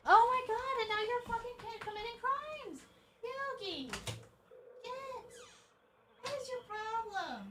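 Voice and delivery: high-pitched exasperated shriek at the cat; scolding the cat in a high, shrill exasperation. Real, not acted. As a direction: squeal in disbelief at the naughty cat.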